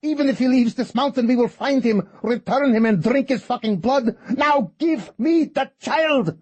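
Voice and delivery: raging rant. Acted. Fortuna threatening Kate and the priest, raging. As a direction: keep raging, spitting every curse.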